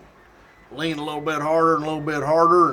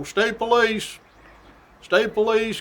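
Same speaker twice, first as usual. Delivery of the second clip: announcing call; 6 semitones higher, slower than usual. Retold or imitated. Trooper climbing stairs, calling out state police. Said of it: "we keep announcing".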